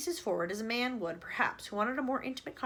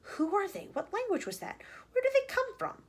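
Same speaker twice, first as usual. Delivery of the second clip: frightened questions; 9 semitones higher than usual. A narrator's reading.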